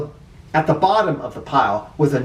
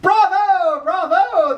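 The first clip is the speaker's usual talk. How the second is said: cheering crowd; slower than usual. Acted. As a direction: call it out like a whole auditorium. Students cheer in story.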